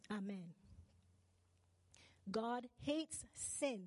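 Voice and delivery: usual speaking voice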